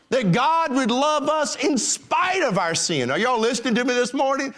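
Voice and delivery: rising preaching. Acted. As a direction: lift the voice and preach it out. Preaching at full force.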